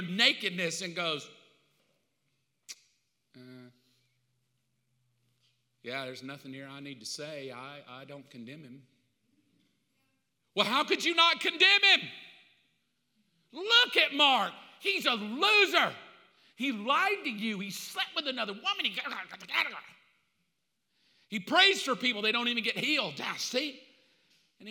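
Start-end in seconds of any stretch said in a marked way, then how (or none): 3.4-8.8 very soft, low, calm verdict; quietly unbothered acceptance; gentle God. Acted. God looks over the sinner, soft and low. 10.6-23.8 higher, louder outraged protest; indignant accuser. Acted. The accuser protests to God about Mark, loud and indignant.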